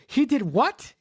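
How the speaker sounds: playing innocent with fake surprise; overreacting. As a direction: act shocked a bit too much. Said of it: "starts to overreact".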